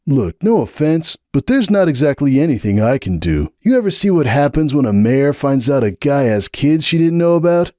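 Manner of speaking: brushing it off with a bitter joke; Hoops' voice. A narrator's reading. Hoops deflecting Sunburst at the door, wry and defensive.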